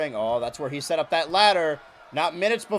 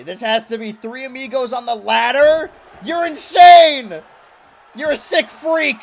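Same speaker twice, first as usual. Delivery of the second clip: screaming commentator; 8 semitones higher, 12 dB louder than usual. Acted. Commentator screams at a crazy spot.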